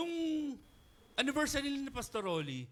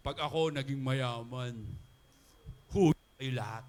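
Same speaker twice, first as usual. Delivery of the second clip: mock boastful vow; 8 semitones lower, 5 dB louder than usual. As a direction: lord it over everyone in jest. Mock vow of rich person.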